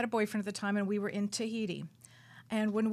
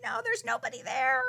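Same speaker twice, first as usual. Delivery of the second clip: mock boyfriend insisting; 12 semitones higher, 3 dB louder than usual.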